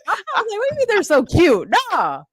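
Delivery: high, slow, incredulous teasing; dragged-out mocking challenge; teasing a friend in a high drawl; mock disbelief. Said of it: "I was like".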